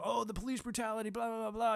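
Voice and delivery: high, sneering mimic of critics; mock-whiny parody of critics; mocking whine. Mocking civilians' complaints.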